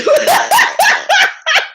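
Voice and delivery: loud, high cackle; bursting out laughing. Real, not acted. Host cracking up at own impression, loud high laughter. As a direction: lose it completely in a shrieking laugh. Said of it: "got the giggles".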